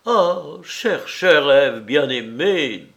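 loud, drawn-out adoring exclamation; impassioned lover. A narrator's reading. Lover falls at her feet. (amoureux transporté, voix forte)

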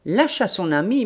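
ordinary delivery

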